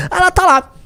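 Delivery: usual speaking voice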